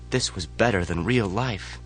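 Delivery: plain reading voice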